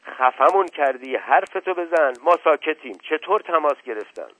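shouting. Acted. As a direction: yell at him to get on with it. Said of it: "دایی جان سرهنگ فریاد زد". (فریاد دایی جان سرهنگ)

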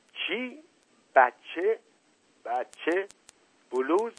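high, rising one-word questions; stunned disbelief. Acted. Uncle hearing girl's confession, stunned questions. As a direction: echo each word, astonished, with pauses.

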